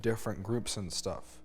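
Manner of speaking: ordinary delivery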